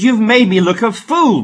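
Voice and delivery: loud, high furious shout at the donkey; Balaam shouting at his donkey, furious; angry shout. A narrator's reading. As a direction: yell it out in rage. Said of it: "Balaam shouted".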